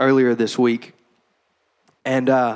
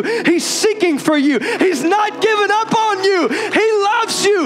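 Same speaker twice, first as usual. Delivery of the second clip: preaching at full force; 12 semitones higher than usual. Acted. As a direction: preach it out, rising to a crescendo. Preacher building to climax.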